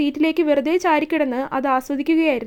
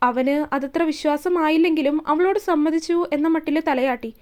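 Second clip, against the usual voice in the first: usual speaking voice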